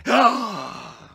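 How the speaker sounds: sharp, loud gasp of recognition; shocked gasp. A narrator's reading. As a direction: gasp as if seeing a ghost.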